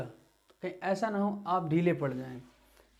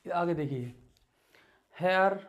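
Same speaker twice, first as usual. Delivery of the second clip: louder, each word called out clearly; read-along drill; 3 semitones higher, 5 dB louder than usual. Acted. Teacher leads the class reading word list aloud. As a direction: call out each word for students to repeat.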